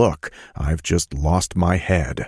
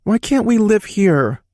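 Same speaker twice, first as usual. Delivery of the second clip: put-on high, young boy's voice; child's voice; 5 dB louder than usual. A narrator's reading. Little boy protesting to his dad about leaving.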